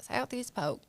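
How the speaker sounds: softly said in a country accent; little country accent. Shown as a demonstration. Host echoes the local name, little country accent. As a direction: add a slight country twang, softly. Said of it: "you gotta do a little country accent".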